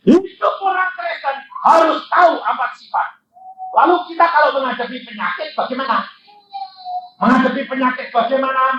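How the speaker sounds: high, forceful preaching voice. Real, not acted. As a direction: drive the questions home from the pulpit.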